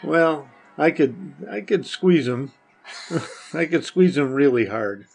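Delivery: normal talking voice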